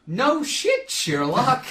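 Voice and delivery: high, loud character voice; Linnea voice. Retold or imitated. Imitating a blooper reply to the captain, loud high character voice. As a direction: call it out in an exaggerated high voice. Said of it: "Linnea voice and everything".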